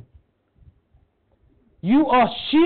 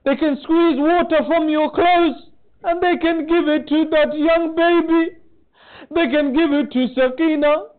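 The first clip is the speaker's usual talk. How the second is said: rising impassioned lament; 5 semitones higher than usual. Acted. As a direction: lift the sermon into mourning. Sermon climbs to grieving climax.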